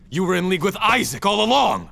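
high, loud outburst; angry accusation. Acted. Hero accusing the sister of betrayal, angry and loud. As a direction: spit out the betrayal.

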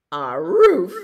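very loud howly ruff; dog bark. Pretend. Storyteller springing the dog on children, loud bark. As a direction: imitate a dog's booming bark. Dog noise in picture book.

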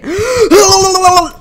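sharp loud gasping cry; shocked cry. Real, not acted. Sudden shock.

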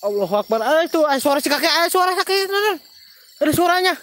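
loud, high, alarmed outburst; startled alarm. Real, not acted. Host hears the old man's voice, alarmed and shouting to viewers.

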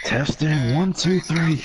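high, drawn-out sing-song test call; mic test. Pretend. Friend asked for a sound check, high mic-test call. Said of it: "I want you to say testing".

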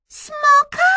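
very high, loud squeaky question; Myszka squealing. A narrator's reading. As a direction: squeal it like a startled mouse. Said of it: "zapiszczała Myszka".

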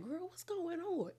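high, very soft imitated bewilderment; bewildered hush. Onlookers puzzled by friends fighting at the party, high hushed bewilderment.

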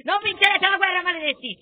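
high, loud battle cry of outrage; defiant shout. Pretend. Defies the Persian enemy.

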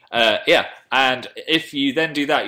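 usual speaking voice

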